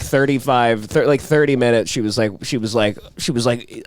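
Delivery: ordinary talk, nothing marked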